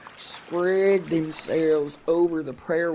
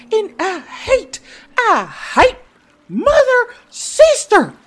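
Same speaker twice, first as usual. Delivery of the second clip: much higher, louder, slow crying scream; tearful shriek; 13 dB louder, slower than usual. Acted. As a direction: wail the name with all your rage. Princess screams her name.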